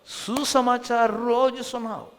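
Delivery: slow, drawn-out opening proclamation; slow preached declaration. Acted. Preacher opens the sermon to the congregation, slow and solemn. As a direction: lay the theme down slowly.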